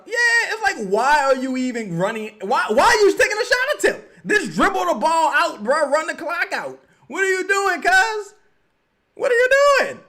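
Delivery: high, loud exasperated shouting; frustrated yelling. Real, not acted. Streamer yelling at the team to run the clock, loud high. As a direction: yell at the players to run the clock.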